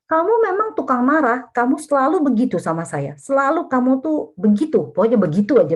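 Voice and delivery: high, loud nagging rant; blaming outburst. Shown as a demonstration. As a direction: rail at the partner with rising heat. Mocking spouse blaming outburst. (omelan keras dan tinggi kepada pasangan)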